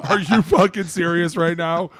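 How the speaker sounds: high, loud and slowed outrage; indignant shout. Real, not acted. Answering a friend's jab, loud and indignant. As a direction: hit every word in disbelief.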